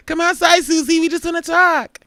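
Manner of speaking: jokingly calling Susie out to fight; hammed-up come-outside taunt; mock threat. Mock threatening comment-section bullies.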